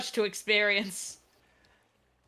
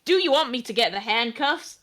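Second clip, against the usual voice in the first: normal talking voice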